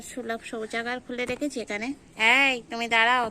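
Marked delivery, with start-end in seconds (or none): 2.4-3.3 loud stop command; sharp scold. Real, not acted. Owner loudly scolds a cat for peeing. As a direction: stop the animal with a loud shout.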